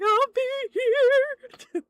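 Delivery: high, loud, drawn-out wail; hammed-up bawling imitation; mock sobbing. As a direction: wail the words out slowly in pretend sobs. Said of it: "sobbing the whole fucking time".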